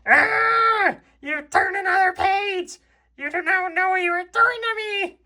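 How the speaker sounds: very high panicked shriek; panicked Toad. Acted. Toad shrieking at the reader for turning the page. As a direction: squeal in alarm at the turned page. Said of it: "Toad voice".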